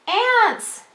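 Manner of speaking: very high alarmed call; Kim yelling. Acted. Teacher voicing Kim's warning in the story, yelling. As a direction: cry out like Kim spotting ants. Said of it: "yells Kim".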